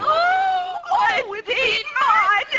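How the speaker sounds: high, loud squeal of delight; starstruck shriek. Acted. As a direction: squeal like a fan seeing her idol. Starstruck lady spots [name].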